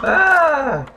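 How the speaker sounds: high, loud ah; startled scream. Real, not acted. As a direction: yelp it in sudden fright. Character falling.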